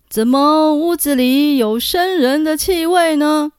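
loud, suspicious ghostly question. A narrator's reading. Spirit sniffs, asks suspiciously.

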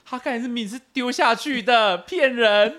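high, loud accusing retelling; indignant protest. Retold or imitated. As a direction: protest to a friend, calling out a trick. Retold protest about the faked catch.

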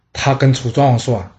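usual speaking voice

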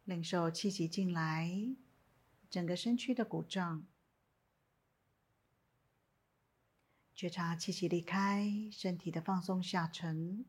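calm, unhurried meditation guidance with long pauses; guided meditation. Acted. Teacher guiding listeners lying down in a body scan, calm meditation voice.

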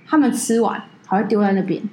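ordinary talk, nothing marked

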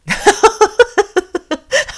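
high, loud burst of laughter; real burst of laughter. Real, not acted. Laughing at the cat's reply about its dad. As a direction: laugh out loud, can't help it.

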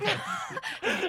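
airy unvoiced laugh bursting out; breathy giggle. Real, not acted. Co-hosts laughing at the story. (忍不住气声笑出来)

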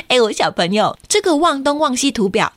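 ordinary delivery, nothing marked

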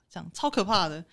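usual speaking voice

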